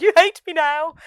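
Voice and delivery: high, loud mock anguish; hammed-up wail. The podcaster mimics Roberto wailing at Joseph. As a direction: wail it like a wounded lover. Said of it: "'cause Roberto's like".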